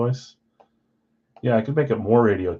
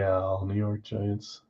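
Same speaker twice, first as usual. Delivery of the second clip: ordinary talk, nothing marked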